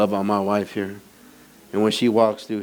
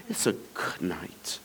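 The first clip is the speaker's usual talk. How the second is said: soft unvoiced aside; whisper; 6 semitones lower, 11 dB quieter, slower than usual. Real, not acted. Pastor aside about his wife, whispered. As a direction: whisper it to the room.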